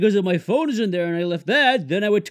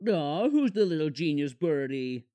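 put-on baby talk; 6 semitones lower, 7 dB quieter than usual. Cooing at the bird.

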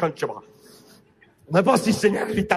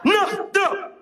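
shouted; 12 semitones higher than usual. Shown as a demonstration. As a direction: blurt it out, claiming it. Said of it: "Nostro riesco a dirlo". (gridato acuto)

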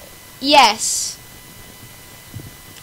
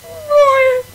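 despairing shout; 11 semitones higher, 6 dB louder, slower than usual. Real, not acted. Falls again.

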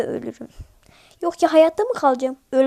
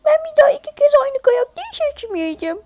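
high, fast whiny protest; 12 semitones higher, 5 dB louder, faster than usual. Real, not acted. Sulking after a loss.

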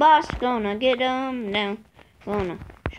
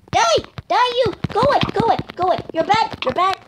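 frantic high yells; 9 semitones higher, 4 dB louder, much faster than usual. Real, not acted. Kid fights off attacking mob.

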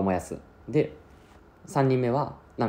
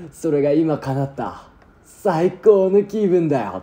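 higher, louder, slow triumphant gloat; gloating villain; 8 semitones higher, 5 dB louder, much slower than usual. Acted. Higure gloats to Akira from the excavator, slow and triumphant. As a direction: savour every word of revenge.

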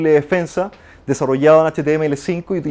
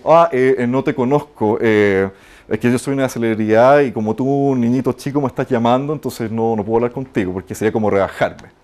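snooty celebrity; 6 semitones lower than usual. Retold or imitated. Imitating arrogant celebrity on phone.

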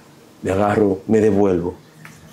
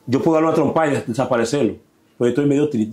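ordinary talk

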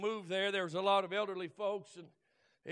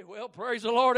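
normal talking voice

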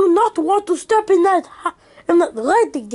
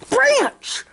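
a high joyful call of the name; excited call; 9 semitones higher than usual. Acted. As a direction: call out in relief. Waking, calling Branch.

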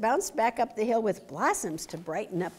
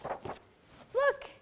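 surprised. Real, not acted. Points out picture to child.

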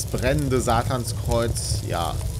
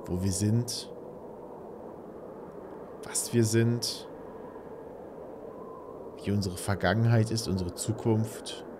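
brooding horror narration; 3 semitones lower, 5 dB quieter than usual. Acted. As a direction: murmur it like a grim narrator. Dramatic narration of horror game.

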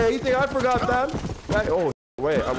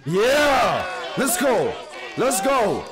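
drawn-out, raised cheer; hyped cheering; 2 semitones higher, much slower than usual. Real, not acted. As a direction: cheer the speech on like a rowdy follower. Cheering on the game's rebel speech.

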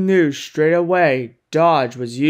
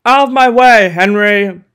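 booming warning call; 3 semitones higher, 6 dB louder than usual. Acted. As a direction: announce yourself like a charging express. Gordon barrels past Henry.

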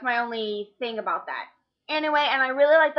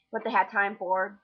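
ordinary talk

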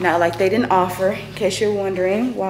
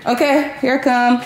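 calling back, higher and louder; yelling across the room; 6 semitones higher, 4 dB louder than usual. Real, not acted. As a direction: call out to the next room.

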